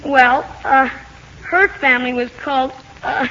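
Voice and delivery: hesitant, with long searching pauses and uhs; stop-and-start recitation from a poor memory; halting recall. Acted. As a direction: stall between phrases, groping for the names. Boy struggles to remember story.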